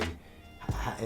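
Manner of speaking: ordinary delivery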